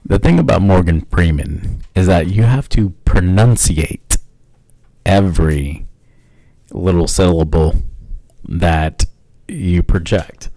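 deep, slow, deliberate narrator voice; low, measured and grandly unhurried; Morgan Freeman. Acted. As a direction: pronounce every syllable, with long weighty pauses. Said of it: "impersonating him now".

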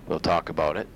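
normal talking voice

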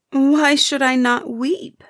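high, slow, grieving plea; tearful lament. Acted. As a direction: ask it slowly, near tears. Weeping girl answers the heifer.

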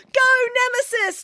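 high, excited cheer. A narrator's reading. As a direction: cheer on your champion. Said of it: "cheered Samantha".

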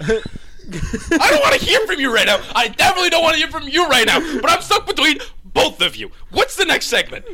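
high, loud, laughing outburst; exasperated laughing rant. Real, not acted. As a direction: rant through laughter, fed up.

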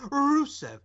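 mock Russian accent on Rusev, R drawn out; hammed-up Russian-accented name. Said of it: "puts on that Russian accent".

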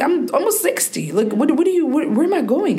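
high-pitched imitation of dad protesting; incredulous father. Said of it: "literally, he's like".

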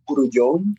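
"Grullon" with a Spanish accent; rolled into "Grullon" as groo-YON; Spanish pronunciation. Shown as a demonstration. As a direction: say "Grullon" the Spanish way, stressed on the last syllable. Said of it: "the correct pronunciation would be".

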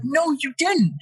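high-pitched mock argument; retold contradiction. Friend correcting him about the garbage bag, retold contradiction. Acted quarrel over the thrown bag.

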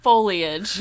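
Foliage said as FOH-lee-ij, three syllables; teacher correcting foliage. Retold or imitated. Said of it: "He's like".

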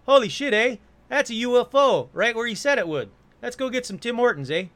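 hint of a Canadian accent, amazed and excited. Local farmer exclaims at the UFO in a light Canadian accent. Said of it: "That's the only Canadian accent I'm doing".

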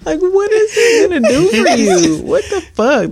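high, laugh-choked question; cracking up in disbelief. Real, not acted. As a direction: ask it through laughter. Laughing at the two dollars.

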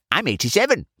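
put-on high old man's boast; proud old man. A narrator's reading. Old man boasts to the chemist, proud aged voice.

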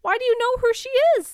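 high, fast disbelieving squeal; can't believe you know this; incredulous. Real, not acted. Podcaster teasing her co-host over obscure trivia, high incredulous squeal.

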